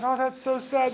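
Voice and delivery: sympathetic bartender. Acted. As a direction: bartender feigning pity. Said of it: "the bartender said".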